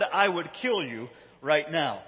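ordinary talk

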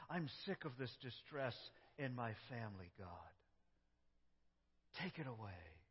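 soft, tired plea to God; a sufferer telling God they are sick of pain; weary complaining prayer. Acted. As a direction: lament quietly, worn out.

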